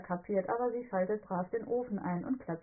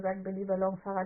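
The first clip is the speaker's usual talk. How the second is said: ordinary delivery, nothing marked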